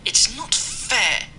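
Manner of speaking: slow whiny complaint; sulky engine moaning it's not fair; complaining. A narrator's reading. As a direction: drag it out, aggrieved. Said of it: "he complained to Henry".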